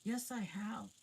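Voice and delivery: put-on high bright girl's voice; little girl voice. A narrator's reading. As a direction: pipe up like a small girl. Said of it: "said Lucy".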